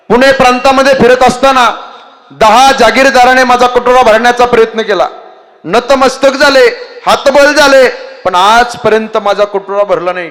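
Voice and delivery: high, loud proud boast; boastful fakir. Acted. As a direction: brag about his bowl with pride.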